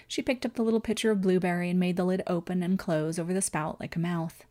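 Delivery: ordinary delivery, nothing marked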